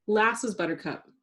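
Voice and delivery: ordinary delivery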